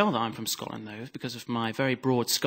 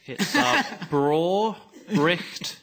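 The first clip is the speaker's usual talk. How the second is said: very slow, laughing Scottish accent, a bit higher; Scottish phrase on request; 6 semitones higher, 3 dB louder, much slower than usual. Shown as a demonstration. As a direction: stretch the Scots words out slowly in a Scottish accent. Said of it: "Say it for me, son".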